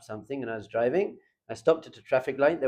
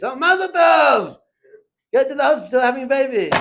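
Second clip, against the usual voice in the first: mock cheer; 11 semitones higher, 9 dB louder than usual. Father jokingly cheers Shabbos drivers to his kids, loud mock celebration. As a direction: cheer at the passing car like it's great news. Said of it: "I say to my kids".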